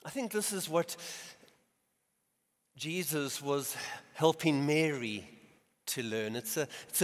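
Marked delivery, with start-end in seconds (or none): none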